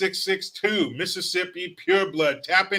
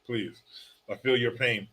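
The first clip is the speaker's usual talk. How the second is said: normal talking voice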